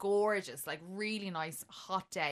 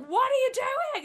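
shocked outburst; 7 dB louder than usual. Retold or imitated. Retold yelp at her date.

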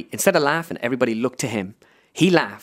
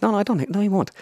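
eager denial; 9 semitones higher, faster than usual. Retold or imitated. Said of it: "I'm like".

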